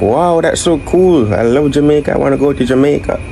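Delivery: high, gushing fan of Jamaica; imitating Americans who love Jamaica; mock gushing American. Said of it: "they're like".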